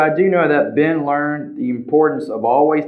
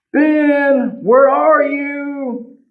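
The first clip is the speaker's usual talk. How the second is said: much higher, slow calling out; parents calling; 12 semitones higher, much slower than usual. Acted. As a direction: call across the woods for your son. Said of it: "his parents asked".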